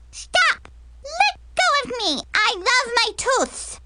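put-on very high child's squeal; panicked little girl. Little girl fights to keep her teeth, squealing. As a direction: squeal in protest as if being grabbed. Child struggles as she is grabbed.

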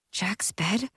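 hushed, unbelieving whisper; whispered disbelief. A narrator's reading. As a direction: breathe it out in disbelief.

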